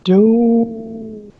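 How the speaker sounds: high, drawn-out mock doom; playful ominous pronouncement; mock dramatic. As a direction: intone it like a grim prophecy, teasing. Teasing friend about losing bonus.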